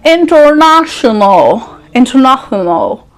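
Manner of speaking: "International" drawn out in an affected accent; exaggerated accent on International; put-on accent. Shown as a demonstration. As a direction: stretch International like a fake foreigner. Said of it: "This is how they say it".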